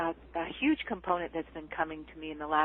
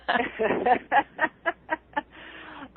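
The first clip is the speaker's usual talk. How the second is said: amused giggle; 7 semitones higher than usual. Real, not acted. Laughing mid-sentence.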